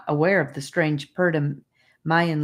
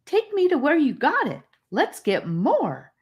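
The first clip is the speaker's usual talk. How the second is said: eager; 11 semitones higher, 3 dB louder than usual. A narrator's reading.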